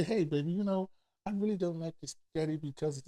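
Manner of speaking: very soft, low, careful husband voice; gentle mock request. Husband kindly telling his wife about the spaghetti, gentle and soft. Models the gentle way not used.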